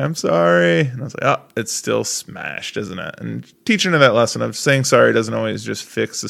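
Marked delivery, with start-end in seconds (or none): 0.0-0.8 put-on high, loud child's sorry; little girl voice. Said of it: "And she's like". 1.4-3.1 slow, soft, mock-sorry tone; mock sad. Teaching daughter a lesson.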